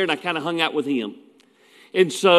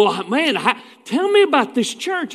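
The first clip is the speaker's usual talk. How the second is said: suddenly interested preacher, pitched up high; mock eager interest; 6 semitones higher than usual. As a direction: imitate a colleague who now badly wants details. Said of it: "Now they're interested".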